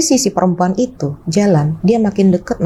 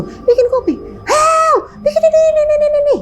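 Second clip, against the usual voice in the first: much higher mock shout of a confused old man; confused father yelling. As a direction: shout high, lost for words.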